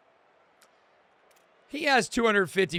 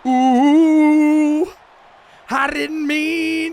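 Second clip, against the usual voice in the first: high, loud, drawn-out mama calls; hyped yelling; 8 semitones higher, 10 dB louder, slower than usual. Real, not acted. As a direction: hype up the runner with each call. Hyped at a big play.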